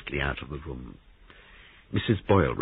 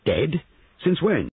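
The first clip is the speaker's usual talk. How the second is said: high, urgent questioning; startled, high-pitched query; sharp alarm; 12 semitones higher, 4 dB louder, slower than usual. A narrator's reading. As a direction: demand answers in alarm, pitch jumping. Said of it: "sharp alarm in his voice".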